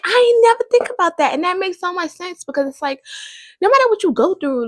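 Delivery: high, fast, awed outburst; amazed realization. Retold or imitated. Vlogger recalling her sermon epiphany, high excited wonder. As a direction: sound like it just clicked. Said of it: "'Cause I'm like".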